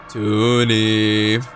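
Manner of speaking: drawn-out haunting call of a name; eerie call. Acted. The dark calling the boy from the doorway. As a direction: call like the night itself.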